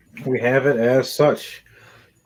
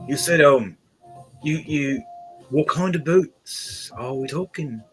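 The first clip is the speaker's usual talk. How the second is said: lightly voiced, hesitant shop man; a hint of a bumbling clerk; fumbling man; 3 semitones higher than usual. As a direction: stumble over the words, slightly flustered. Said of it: "this man fumbling over".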